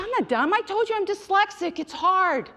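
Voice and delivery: put-on young woman answering her boyfriend, indignant; indignant protest. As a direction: defend yourself against being called dumb. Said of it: "I said".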